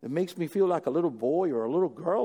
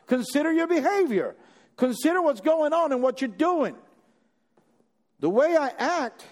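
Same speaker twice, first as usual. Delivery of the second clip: raised, rising sermon push; preaching at full force; 9 semitones higher, 5 dB louder than usual. Acted. Preacher urging the church to examine their behavior, with mounting force. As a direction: drive the point home to the congregation.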